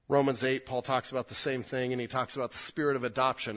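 normal talking voice

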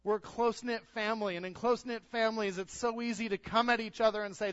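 loud, high, driving sermon voice; preaching at full force. Acted. Pastor to church, preaching at full force. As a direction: preach it hard to the congregation.

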